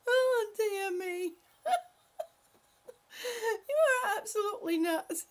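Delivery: high, squeaky words through tears of laughter; real helpless laughter breaking each word; crying with laughter at a viewer's joke; laughing. Real, not acted. Cracking up at a joke.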